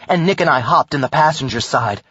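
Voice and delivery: plain reading voice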